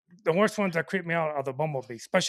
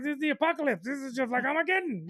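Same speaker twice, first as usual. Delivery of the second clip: mock doom; 7 semitones higher than usual. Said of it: "And I'm just like".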